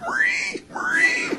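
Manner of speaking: very high squealing pig voice crying the name; comic piglike shriek of the name. Retold or imitated. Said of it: "they were just screaming".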